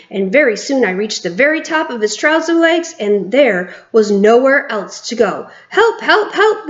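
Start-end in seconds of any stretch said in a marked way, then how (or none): 5.7-6.7 high, frantic cries for help; screaming. A narrator's reading. Cook screaming for help as the mouse climbs, high frantic cries. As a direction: scream for help in a hammed-up panic. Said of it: "the man was screaming".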